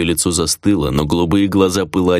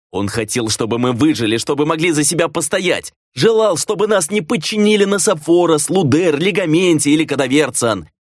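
impassioned, pitched up through the middle; angry self-justification; 8 semitones higher than usual. A narrator's reading. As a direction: argue heatedly for what you did. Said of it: "Его лицо перекашивало от гнева".

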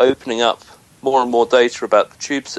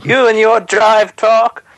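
high, slow, loud Norfolk yokel voice; Norfolk accent; 9 semitones higher, 5 dB louder, slower than usual. Pretend. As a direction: bellow it in broad Norfolk. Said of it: "Tom's Norfolk impression".

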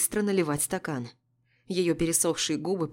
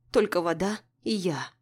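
ordinary delivery

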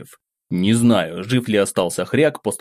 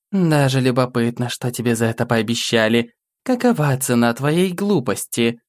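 demoness voice. A narrator's reading. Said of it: "покачала головой демоница".

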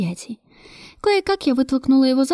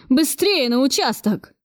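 slow, higher-pitched angry order; mild urgent roar; 4 semitones higher, slower than usual. A narrator's reading. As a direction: order them out, roar held back. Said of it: "взревел директор". (грозный приказ без крика)

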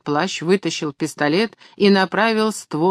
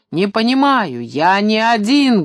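bellowed; 5 semitones higher, 5 dB louder than usual. A narrator's reading. Maxim refusing to leave his friends, bellowing. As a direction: insist loudly, stretching the words. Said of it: "гаркнул Максим".

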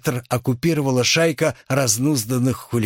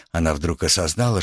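usual speaking voice

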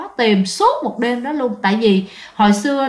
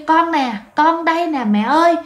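calling out to mother; 6 semitones higher than usual. Retold or imitated. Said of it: "cái chị mới kêu".

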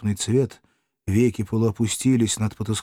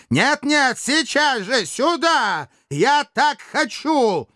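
high, drawn-out imperious yell; furious demand; 14 semitones higher, much slower than usual. Acted. Dictator refuses to wait, yelling furiously at his servant. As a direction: insist at the top of your pitch.

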